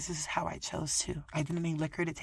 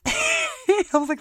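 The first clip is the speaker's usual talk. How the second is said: laughing; 9 dB louder, much slower than usual. Real, not acted. Laughing mid-story.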